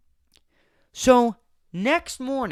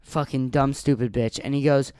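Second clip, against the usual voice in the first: ordinary talk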